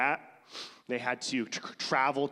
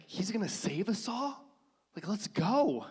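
high, cheerful mock Herod; mock excited Herod; 8 semitones higher than usual. Preacher imagining Herod welcoming the Messiah, mock cheer. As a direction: lightly voice an eager Herod. Herod voiced as he should react.

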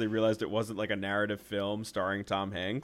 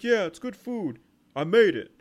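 high goofy son, getting louder; dim son voice; 8 semitones higher, 6 dB louder than usual. Retold or imitated. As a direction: play the dopey son proudly. Fat son answers the mobster.

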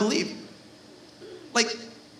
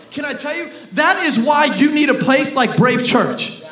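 ordinary talk, nothing marked

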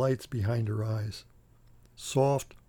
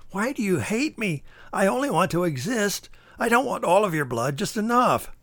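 high, strange pleading creature voice; whiny leech; 6 semitones higher than usual. Acted. Leech pleading with the clown for some blood. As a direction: plead like a parasite that feels wronged.

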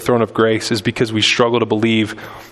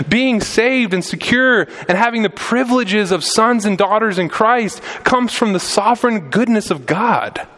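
higher, building sermon cry; impassioned preaching; 8 semitones higher than usual. Acted. Pastor urging his church, preaching at full force. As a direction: preach it out to the congregation.

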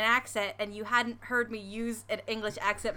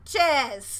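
mock English cheers; 3 semitones higher, 6 dB louder than usual. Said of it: "without being like".

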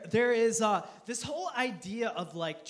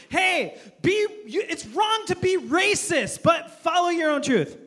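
high, loud crusading insistence; hammed-up righteous scolding; mock preachy; 9 semitones higher, 6 dB louder than usual. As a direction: exhort loudly, as if at a crowd. Acts out contradictory public voices.

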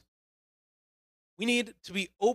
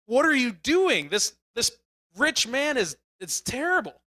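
higher and louder, indignant cry; upset outburst; 6 semitones higher, 4 dB louder than usual. Retold or imitated. Reader reacting to the rich man's deed, upset and loud. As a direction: let it burst out like a shocked reader. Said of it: "You get emotional and you get upset".

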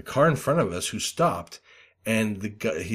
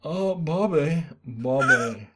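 slow, higher, disappointed cartoon dad; Hank Hill sighing Bobby; 6 semitones higher, much slower than usual. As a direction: drag out the name like a let-down father. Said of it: "terrible Hank Hill impression".

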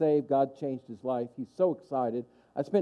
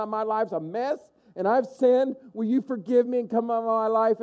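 raised, driving gospel plea; impassioned testimony; 6 semitones higher than usual. Acted. New convert explains salvation to his sister, impassioned. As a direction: urge it on, pitched high throughout.

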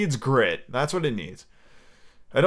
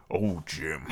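low, soft villainous croon; half-joking deep pirate impression; Tim Curry; 8 semitones lower, 9 dB quieter than usual. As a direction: sink low and quiet, chuckling round it. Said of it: "able to do a Tim Curry".

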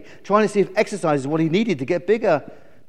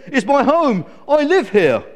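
high, loud indignant eagle; affronted eagle defending home; squawking; 6 semitones higher, 7 dB louder than usual. Acted. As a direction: insist crossly at full squawk. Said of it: "squawked Glen".

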